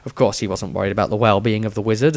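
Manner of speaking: ordinary delivery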